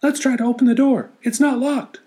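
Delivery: worried little animals asking; high squeaky wondering animals. Acted. Animals speaking.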